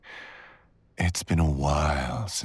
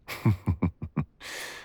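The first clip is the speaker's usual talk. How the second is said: amused chuckle; 3 semitones higher than usual. Acted. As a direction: chuckle at the little fox. Amused at clever intruder.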